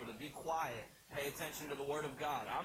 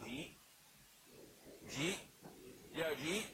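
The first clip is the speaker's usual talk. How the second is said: Philly jeet. Shown as a demonstration. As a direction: ask it like a quick Philly question. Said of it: "it just comes out like".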